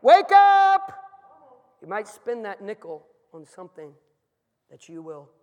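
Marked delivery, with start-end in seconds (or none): 0.0-0.9 full-force high holler; very loud, high-pitched preacher's yell; urgent wake-up shout. Acted. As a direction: shout it to jolt the whole room awake. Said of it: "youth conference that says".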